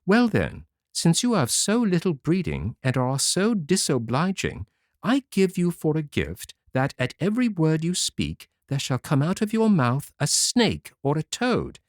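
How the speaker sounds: put-on fairy calmly scolding the girl. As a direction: rebuke without anger. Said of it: "without putting herself in a passion".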